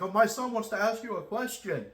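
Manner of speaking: hammed-up proud father pushing his son forward; dad's voice, high and loud, putting his son on the spot; eager dad voice. Dad volunteers son to zookeeper.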